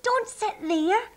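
high, urgent warning; warning shout. A narrator's reading. Rabbit warns the sheepdog about the park bench, crying out high. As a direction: call out high to stop him. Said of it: "shouted Beebe".

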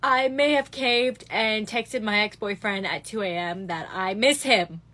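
ordinary delivery

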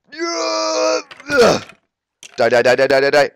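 loud gleeful shouted war cry; battle yell. Real, not acted. Attacking the skeleton, shouting battle cries. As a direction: yell each die as you strike.

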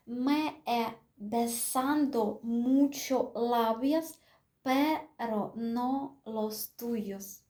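slow dictation of the Spanish line; slow, little by little. Shown as a demonstration. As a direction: draw each word out for learners. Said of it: "Потихеньку, помаленьку".

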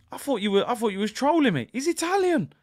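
high-pitched, astonished realisation; incredulous. Real, not acted. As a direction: voice leaping up in disbelief. Realising he was wrong.

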